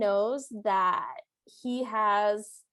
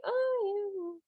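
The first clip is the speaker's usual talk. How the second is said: high, softer noncommittal hedge; mock so-so hedging, pitched up; waffling; 9 semitones higher, 4 dB quieter than usual. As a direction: imitate someone wavering on an answer. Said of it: "he kinda waffles his hand back and forth like".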